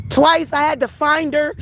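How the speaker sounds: ordinary delivery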